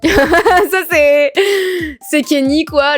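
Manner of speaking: high-pitched giggly reveal; laughing. Real, not acted. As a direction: laugh your way through the words. Cracks up at the Kenny character.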